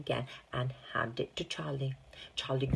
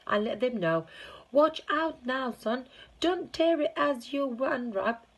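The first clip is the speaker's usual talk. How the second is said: excited shouting; 10 semitones higher, 4 dB louder than usual. Acted. Shopkeeper excited, calling out.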